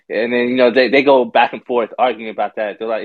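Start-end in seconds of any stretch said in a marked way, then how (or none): none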